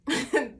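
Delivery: a quick burst of laughter; short laugh. Real, not acted. Speaker laughing at her pudding story, short laugh. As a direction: laugh at the memory.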